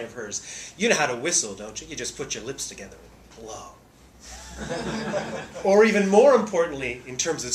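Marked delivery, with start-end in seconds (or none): 0.8-3.7 a hint of sultry Lauren Bacall, low and soft; Bacall line. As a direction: lightly purr the famous line. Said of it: "enjoys saying that famous line".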